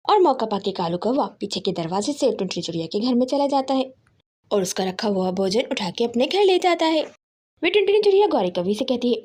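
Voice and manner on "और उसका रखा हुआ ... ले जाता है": ordinary delivery, nothing marked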